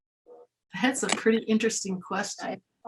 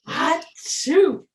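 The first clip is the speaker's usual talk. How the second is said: sneeze; 7 semitones higher, 4 dB louder than usual. Acted. Fox sneezes.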